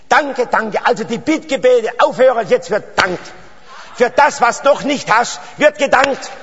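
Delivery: high, loud exhortation; insistent pulpit call; emphatic preaching. Real, not acted. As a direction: drive the command home to the congregation. Preaches at full force.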